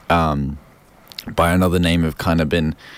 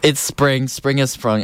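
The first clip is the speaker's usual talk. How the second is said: excited; 7 semitones higher than usual. Real, not acted. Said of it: "I'm excited".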